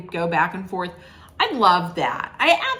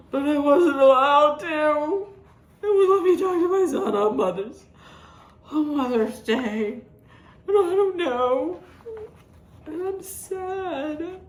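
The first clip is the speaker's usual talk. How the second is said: mock whining; 7 semitones higher than usual. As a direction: whine it like a pitiful sulking woman. Mocking Meredith's whining complaint.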